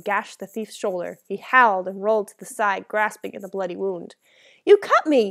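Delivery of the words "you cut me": loud, high-pitched shocked outcry; outraged, incredulous yell; disbelief. A narrator's reading. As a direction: cry out, unable to believe it. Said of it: "he said in disbelief".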